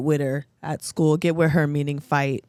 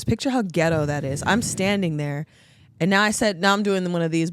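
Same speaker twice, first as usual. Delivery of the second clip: normal talking voice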